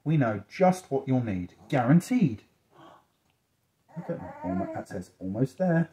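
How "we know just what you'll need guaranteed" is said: lively picture-book narration, key words punched louder; reading to children. A narrator's reading. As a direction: read it like a cheerful promise to a child.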